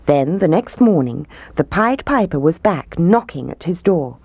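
ordinary delivery

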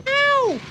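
very high, soft yelp; little squeaked ow; high ow of pain. Acted. Town Mouse fallen in wet grass, yelping.

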